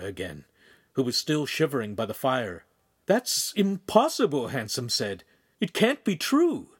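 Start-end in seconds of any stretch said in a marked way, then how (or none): 3.1-4.5 high, slow protest; stunned and drawn-out denial; shocked disbelief. A narrator's reading. Told of the priest's death. 5.6-6.7 high, slow protest; stunned and drawn-out denial; shocked disbelief. A narrator's reading. Told of the priest's death.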